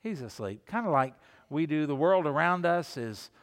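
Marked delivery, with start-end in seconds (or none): 0.0-0.7 soft and sad. Shown as a demonstration. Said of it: "what's happening to the man of God".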